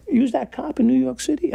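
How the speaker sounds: high-pitched imitation of an incredulous inmate; challenging question.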